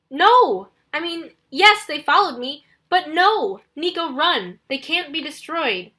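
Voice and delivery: urgent flustered warning in a fight. Acted. Percy urging Nico, under attack.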